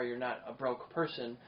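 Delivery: ordinary talk, nothing marked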